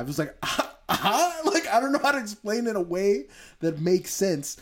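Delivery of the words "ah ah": high, pained fake laugh; forced high "ah, ah" laugh; painful laugh. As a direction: imitate a shocked, painful laugh. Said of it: "it was a painful laugh".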